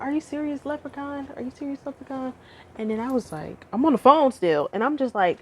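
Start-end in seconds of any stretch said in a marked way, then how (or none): 0.0-2.3 mom. Retold or imitated. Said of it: "It's like".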